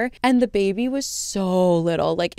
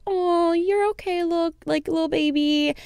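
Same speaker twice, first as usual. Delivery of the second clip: put-on much higher baby-talk coo; cooing bystanders; 9 semitones higher than usual. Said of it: "everyone around him was like".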